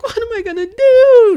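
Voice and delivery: high, loud crying wail; hammed-up weeping cry of despair; mock sobbing wail. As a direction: wail it through fake sobs. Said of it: "and she's like".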